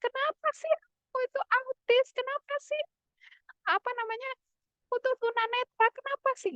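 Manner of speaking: lightly voiced upset parent asking why; angry parent. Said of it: "kalau misalnya orang tuanya marah".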